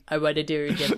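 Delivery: low, half-hearted Trump take; bad Trump. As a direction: try a Trump voice and miss. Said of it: "That was not Trump".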